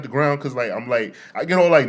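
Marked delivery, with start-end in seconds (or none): none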